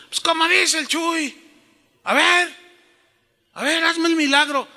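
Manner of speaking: high-pitched mock demand to God; demanding challenge. Mimicking followers who challenge God for a miracle, high-pitched and demanding. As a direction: demand it like an overconfident imitator. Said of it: "No, así yo no digo".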